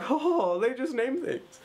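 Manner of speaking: high mock laughing voice; retold shared laugh. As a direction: imitate laughing along, pitched well up. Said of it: "we have a laugh".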